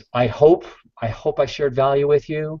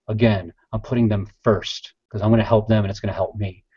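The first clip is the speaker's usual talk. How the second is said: ordinary talk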